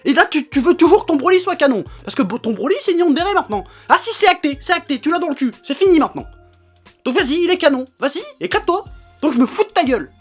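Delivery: loud, furious ranting; angry rant. Real, not acted. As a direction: yell it out, fed up and furious. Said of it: "je me suis un petit peu énervé".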